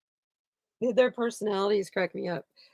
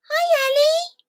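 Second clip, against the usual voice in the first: much higher sing-song pet voice; cat talk; 7 dB louder, slower than usual. Real, not acted. Owner greeting her cat Ellie in a high pet voice. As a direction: coo a high hello to the cat.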